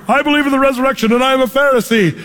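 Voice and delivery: fast, high, eager outburst; excited Paul. Retold or imitated. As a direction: blurt it out like lobbing a grenade. Paul throws grenade into council.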